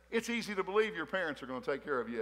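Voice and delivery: normal talking voice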